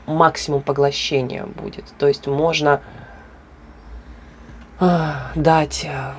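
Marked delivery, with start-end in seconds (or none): none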